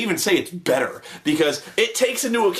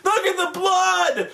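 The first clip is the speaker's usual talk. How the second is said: high, drawn-out mock amazement; hammed-up wowed cry at the gore; amazed meathead; 12 semitones higher, much slower than usual. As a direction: imitate an easily thrilled gore fan. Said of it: "so easily amused by this shit".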